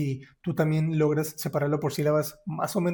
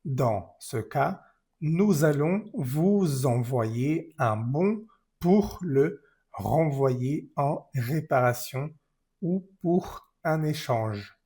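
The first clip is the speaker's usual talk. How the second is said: slow, careful read of the sentence; teacher reading the full sentence slowly; slow clear reading; much slower than usual. Shown as a demonstration. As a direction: read the whole line slowly for learners. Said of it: "si leemos la frase".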